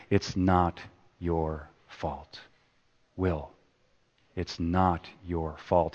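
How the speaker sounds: soft, slow and tender; quietly telling him it isn't his fault; gentle reassurance. Acted. Counsellor softly comforting a troubled young man, slow and tender. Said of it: "Sean keeps saying to him, like a broken record".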